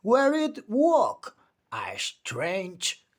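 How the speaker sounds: usual speaking voice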